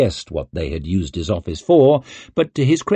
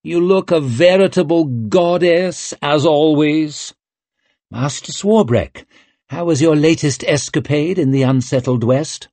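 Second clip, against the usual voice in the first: higher, theatrical flattering courtier; pompous writer; 6 semitones higher, 3 dB louder than usual. A narrator's reading. Swarbrek to Savine on the balcony, pompous and theatrical. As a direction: ham up the fearless adventurer boast.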